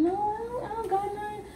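small, high-pitched innocent voice; innocent denial. Acted. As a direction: play innocent, high and soft. Innocent denial when caught, high and quiet.

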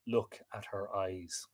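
soft, lingering, fading away; hushed echo. A narrator's reading. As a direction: let the cry sink to a slow murmur.

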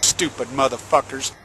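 low, quiet contempt; disgusted aside. Real, not acted. Ranter reacting to the utility's plan, disgusted aside. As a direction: drop it low and soft under the breath. Muttered insult after the news clip.

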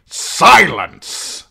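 loud, commanding bark; father silencing his son in court; stern command. A narrator's reading. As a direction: cut him off with authority. Lord Tywin silences Tyrion.

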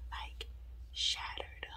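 hushed, breathless whisper. Real, not acted. Homeowner whispering to viewers about the shattered door. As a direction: whisper it as if someone might hear. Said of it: "I don't know why I'm whispering".

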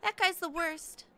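high-voiced, disapproving class rep; annoyed class rep. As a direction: complain in a high schoolgirl voice.

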